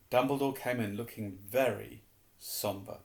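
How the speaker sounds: usual speaking voice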